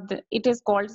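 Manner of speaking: ordinary talk, nothing marked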